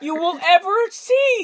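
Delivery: high slow mock-tragic emphasis; milk the sadness; mock dramatic. Friend hamming up the saddest scene, slow and high. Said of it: "overdramatic emphasis".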